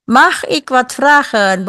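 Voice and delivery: slow, careful Dutch model sentence; slow model question. Shown as a demonstration. Teacher slowly models a polite Dutch question. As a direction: spell it out slowly for the class. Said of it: "Bolehkah saya bertanya?".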